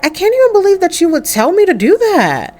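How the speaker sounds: high, quick indignant protest; indignant disbelief. Acted. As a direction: protest in disbelief, pitch jumping up. Daughter protests mother's ultimatum.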